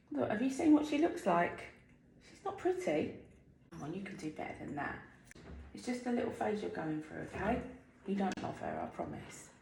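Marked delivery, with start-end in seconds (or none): 3.7-9.5 hushed, fast coaxing; soft coaxing. Real, not acted. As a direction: murmur it gently to the pet.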